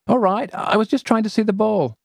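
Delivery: put-on high, huffy kid reply; defensive girl. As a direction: justify yourself. Celia defensive.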